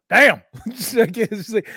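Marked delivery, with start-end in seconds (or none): 0.1-0.4 loud imitated exclamation of respect; impressed guy. Guy overhearing a hard pee, loudly impressed. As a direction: call it out, mock-impressed. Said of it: "you'll hear a guy be like".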